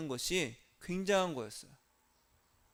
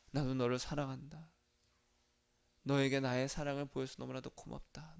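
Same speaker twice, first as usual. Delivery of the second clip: soft, tender divine voice; gentle voice of Jesus; 4 semitones lower, 6 dB quieter than usual. Pretend. Jesus answering the praying believer, soft and tender. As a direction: speak as a loving still small voice. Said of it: "세밀하게 탁 들리는 그 음성이".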